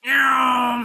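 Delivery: loud, high vocal zoom; zooming whoosh. Acted. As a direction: zoom like a cartoon flyer. Imitated whoosh of flight.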